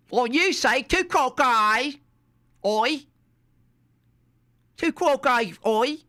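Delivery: high, slow mock cockney with oi; high hammed-up cockney mocking tu quoque; mock British accent. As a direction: tease him in a hammed-up cockney voice.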